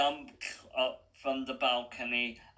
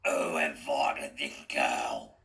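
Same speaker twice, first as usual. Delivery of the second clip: much lower, slow Cockney rasp; a menacing Cockney villain, low and slow; raspy Cockney accent; 9 semitones lower, slower than usual. A narrator's reading. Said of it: "thick, raspy Cockney accent".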